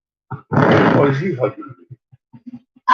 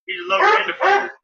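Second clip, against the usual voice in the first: excited fast exclaim; much faster than usual. Real, not acted. Excited exclaim about dog.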